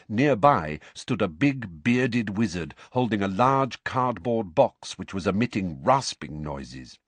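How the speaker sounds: ordinary delivery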